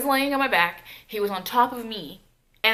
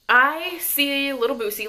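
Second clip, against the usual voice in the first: usual speaking voice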